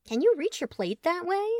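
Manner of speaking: high, curious question. Acted. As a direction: ask it with real puzzlement. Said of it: "genuinely curious".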